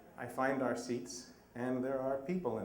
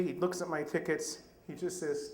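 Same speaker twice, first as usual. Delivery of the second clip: ordinary delivery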